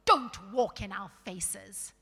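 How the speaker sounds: loud, surly complaint. A narrator's reading. Surly dwarf telling strangers to watch their step, loud and surly. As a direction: grumble it loudly at whoever is in the way. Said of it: "in a surly voice".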